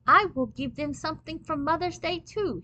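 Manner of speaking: put-on eager offer in a light French accent; Pierre Boulanger. A narrator's reading. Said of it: "a voice with a foreign accent said".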